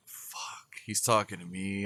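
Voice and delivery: hushed, sinking aside to himself; dismayed whisper. Retold or imitated. Son hearing his pops call him, hushed dismay. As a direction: whisper the curse, then mutter low and soft.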